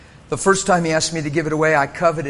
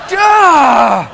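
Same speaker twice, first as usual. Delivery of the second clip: mock shouted duh, higher; sarcastic duh; 8 semitones higher, 11 dB louder than usual. Preacher repeating his sarcastic answer to God, shouted. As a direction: bark the duh in self-mockery.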